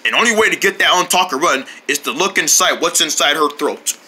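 usual speaking voice

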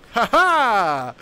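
high, loud "ha ha"; triumphant laugh. Acted. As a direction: crow in triumph over a beaten foe. Mock triumph over opponent in game.

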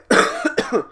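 sharp dry cough mid-sentence; coughing fit. Real, not acted. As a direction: cough hard, breaking off the line. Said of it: "I'm sorry I'm coughing so much".

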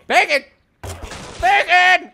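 high, loud double shout; bacon yell. Acted. Gamer yelling his catchphrase at viewers, loud and high. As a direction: shout it out twice.

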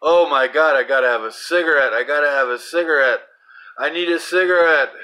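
loud, high, frantic craving; desperate for a smoke, pleading; a smoker in the grip of craving, frantic; craving panic. Retold or imitated. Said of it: "and I'm like".

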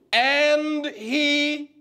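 AND HE held long on a high, sustained note; drawn-out reading prompt. Shown as a demonstration. Teacher cueing the class to read verse two, drawn-out prompt. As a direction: stretch AND and HE, left hanging for the class.

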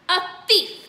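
high, loud furious scream. Acted. Headmistress screaming accusation at Bruce. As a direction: scream it at the boy. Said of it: "the Trunchbull screamed".

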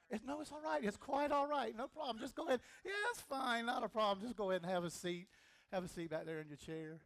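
high, loud it's-fine over the music; loud flustered reassurance. Acted. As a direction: shoo her back to her seat, rattled. Flustered, urging subject to sit.

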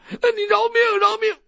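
high, loud cringing plea; begging for mercy. Acted. Captive doctor pleading under the blade, high frightened plea. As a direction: whine for mercy, scared stiff.

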